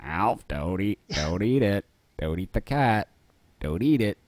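a lightly voiced exasperated dad; Willy impression. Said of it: "play both of them".

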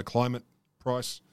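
ordinary delivery

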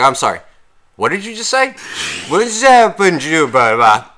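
high, incredulous "what did you say"; sarcastic bartender pretending not to understand; mock disbelief. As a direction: play dumb, rising and loud.

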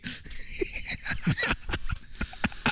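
wheezing laugh. Real, not acted. Big laugh.

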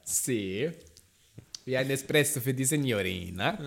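fake Italian accent. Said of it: "Fake-Italienisch gesprochen".

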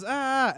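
high-pitched imitated "ah"; mock startled cry. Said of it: "walks in the room and he goes".

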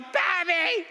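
put-on high, loud kid's plea; child calling out. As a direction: call for help like a stuck child. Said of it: "He's over in the field going".

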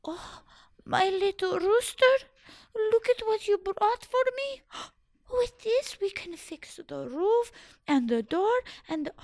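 put-on delighted old woman. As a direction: gush over the rooster with joy.

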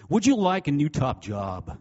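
hammed-up American infomercial accent. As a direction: sell it like a loud American TV ad. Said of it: "I'll do an American accent".

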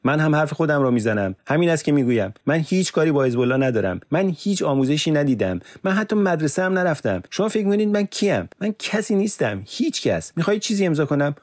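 high, loud furious protest; sharp retort. Acted. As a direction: fire back at the interrogator, rising into desperation. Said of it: "یوسف به تندی پاسخ داد".

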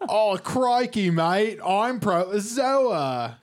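loud, slow, high hammed-up Australian accent; overdone Aussie "crikey mate" voice; fake Australian accent. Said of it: "doing a fake Australian accent".

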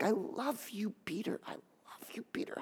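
very soft, fading into whisper; tender whisper. Acted. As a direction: murmur love, sinking to a whisper. Voicing Jesus's loving look at Peter.

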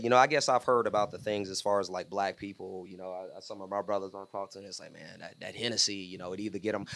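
very quiet mumble; trailing off. Real, not acted. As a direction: let it drop to a mumble. Fading into mumble.

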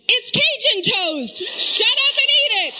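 mock snapping mom. Mom mock-snapping at her daughter over burnt toast. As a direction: bark it back with a grin.

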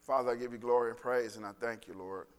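low, earnest pastoral prayer voice. Acted. Pastor praying for the congregation, earnest and low. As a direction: pray over the congregation, unhurried.

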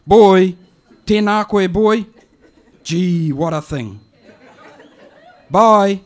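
put-on high, loud calling out to the boy; an old auntie hollering at an answer phone; high loud auntie voice shouting for her nephew. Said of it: "it goes like this".

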